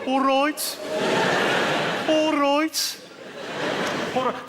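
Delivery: high, loud Brummie all right; Birmingham accent. Retold or imitated. Comic imitating a Birmingham local, high Brummie voice. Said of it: "do the Birmingham accent".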